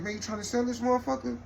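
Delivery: high, fast eager mock question; eager cousin. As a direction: rush the offer like a hustler. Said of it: "he like".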